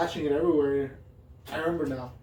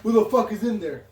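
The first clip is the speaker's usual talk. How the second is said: retold yell; 6 semitones higher, 7 dB louder than usual. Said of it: "I heard him yell".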